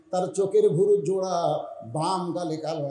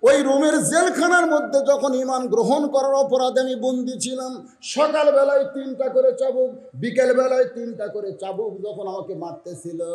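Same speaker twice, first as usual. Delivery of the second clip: tearful telling; 9 semitones higher, 5 dB louder than usual. Acted. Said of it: "মেয়েটা কান্দে আর বলে".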